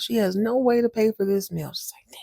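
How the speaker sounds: usual speaking voice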